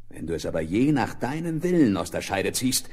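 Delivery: ordinary talk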